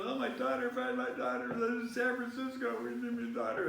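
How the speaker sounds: high imitated plea for a lost daughter; pleading TV mother. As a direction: beg like a desperate mother on TV. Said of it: "and the lady's going".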